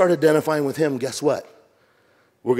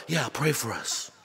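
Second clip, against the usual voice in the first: soft request; 10 dB quieter, slower than usual. Retold or imitated. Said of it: "they all get in a huddle".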